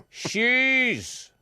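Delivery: piercingly high, loud order; imitated shout. Security woman repeating order to passenger, imitated shout. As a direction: yell it high after someone walking off. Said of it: "and she, she shouted".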